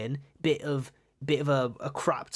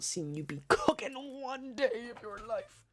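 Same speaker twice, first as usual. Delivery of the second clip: laughing; 9 semitones higher, 6 dB quieter than usual. Real, not acted. Teasing a chatter.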